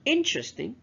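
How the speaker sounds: INteresting, IN pitched high; "Interesting" stressed at the front; stress on first syllable. Shown as a demonstration. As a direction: hit the very start of "Interesting". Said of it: "the first syllable is underlined".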